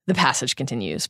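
usual speaking voice

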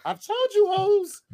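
very high squeaky mouse threat; Mickey Mouse voice. Pretend. Mickey warning the hosts, in a high squeaky mouse voice.

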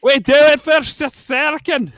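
high, slow, full-throated battle yell; rally the army, booming; rousing war cry. Pretend.

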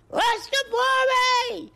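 very high, drawn-out falsetto crow; slow piercing cock-a-doodle delivery; rooster crow. Retold or imitated. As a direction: crow the word like a cockerel. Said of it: "says the cock".

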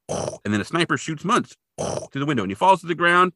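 ordinary delivery

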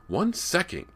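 high, loud, cutting reply; sharp. A narrator's reading. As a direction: snap back at a pestering brother. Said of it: "Callie told him sharply".

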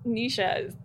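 usual speaking voice

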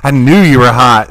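patient greeting the handsome doctor, loud and gleeful; triumphant blurt. Retold or imitated. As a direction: blurt it out, delighted.

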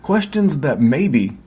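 upbeat promo voice-over read. Acted. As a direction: sell the Patreon like a radio spot. Podcast ad read over music.

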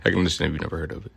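laughing. Real, not acted. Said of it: "I'm just playing".

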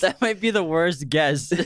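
high, slow, helpless laughter in the voice; laughing. Real, not acted. Host ribbed for being decades off, laughing as he talks. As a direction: laugh through the words. Cracking up at a wrong guess.

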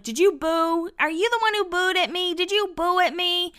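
high, put-on teasing interrogation; [name]'s playful accusing. Cast member asking the crowd who booed, playfully accusing in a high voice. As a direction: imitate a reality star jokingly confronting her hecklers. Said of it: "decided to just have fun with it".

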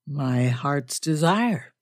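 drawn-out, understated enthusiasm; enthusiastic. A narrator's reading. Major accepting a stranger's invitation, slow mild enthusiasm. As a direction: savour it slowly, mildly keen. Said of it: "said with enthusiasm".

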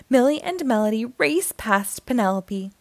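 usual speaking voice

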